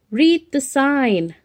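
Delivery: plain reading voice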